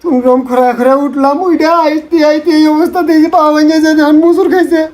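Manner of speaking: put-on wailing account; hammed-up tearful whine; fake sobbing. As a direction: sob between phrases for sympathy. Tearful sham account of crash.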